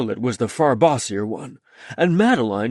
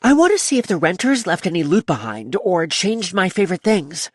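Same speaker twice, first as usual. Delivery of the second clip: put-on little girl voice; 7 semitones higher than usual. A narrator's reading. Child Charlotte voiced by narrator.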